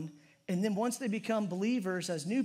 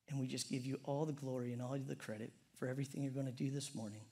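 low, soft, unhurried prayer voice; quiet prayer; 5 semitones lower, 6 dB quieter than usual. Acted. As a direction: pray humbly to the Father, softer and lower. Opens a prayer.

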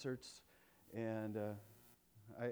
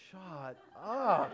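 laughing disbelief; 4 semitones higher, 4 dB louder than usual. Retold or imitated.

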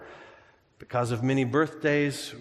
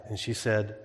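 ordinary talk

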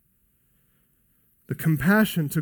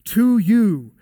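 "To you" punched, louder and higher; emphatic "To you"; 3 semitones higher, 5 dB louder than usual. Shown as a demonstration. Preacher stressing the command's target, "To you" punched loud. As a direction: hit "To you" hard as the key words. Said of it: "are in an emphatic position".

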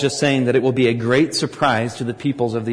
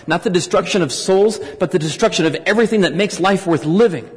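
rising, louder preaching on God's wrath; preaching at full force; 4 semitones higher, 3 dB louder than usual. Acted. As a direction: build to a forceful sermon climax.

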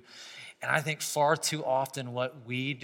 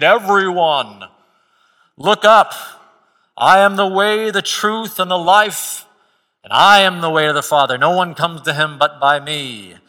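proclaiming from heaven; 3 semitones higher, 13 dB louder than usual. Pretend. Jesus imagined calling from heaven.

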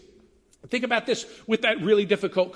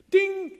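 email ding; 13 semitones higher, 3 dB louder than usual. Retold or imitated. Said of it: "You open your email".

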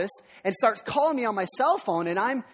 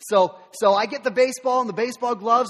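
usual speaking voice

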